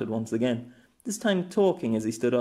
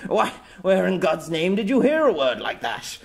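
put-on louder, higher scandalised old man; shocked splutter; 7 semitones higher, 5 dB louder than usual. Old man scandalised by the girl's word, loud splutter. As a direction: splutter in mock outrage.

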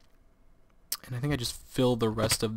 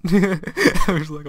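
ordinary delivery

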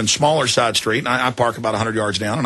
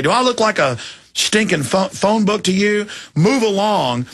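pitched-up, scornful brush-off; mock rude cop; 7 semitones higher than usual. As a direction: sneer it like a cop waving someone away. Cops brushing off a question.

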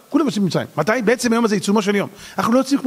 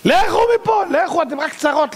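high shouted dismissal, drawn out; exasperated shout; 10 semitones higher, slower than usual. Acted. Egyptians sick of trouble ordering Israelites out, shouting exasperatedly. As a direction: drive them away with an acted yell.

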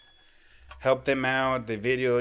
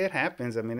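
usual speaking voice

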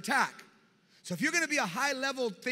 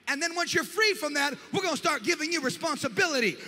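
loud, higher rising sermon cadence; preaching at full force; 7 semitones higher, 7 dB louder than usual. Real, not acted. Preacher rousing the congregation about their future, at full force. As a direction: build the crowd to a peak.

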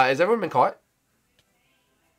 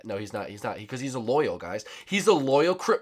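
ordinary talk, nothing marked

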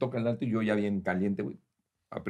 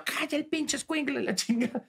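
mock yell; 12 semitones higher, 5 dB quieter than usual. Telling wife to hush child.

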